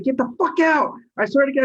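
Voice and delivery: high-pitched heated command, as retold; retold angry order. Driver ordering passenger from moving van, retold angry order. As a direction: order him out, worked up in the retelling.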